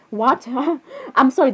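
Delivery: ordinary talk, nothing marked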